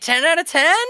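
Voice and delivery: imitated high, hopeful question; mock-bubbly clerk checking good news; chirpy shop clerk. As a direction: pitch it way up, delighted. Clerk mishears, delighted.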